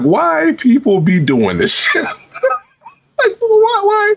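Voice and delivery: slow line breaking into a high laughing cry of why; retelling the creepy gamer, wailing why through laughter; laughing disbelief. Retold or imitated. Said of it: "So that's why I was like".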